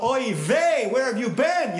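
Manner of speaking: put-on high, loud Yiddish-accented worried mother. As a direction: scold like a fretting Yiddish mother.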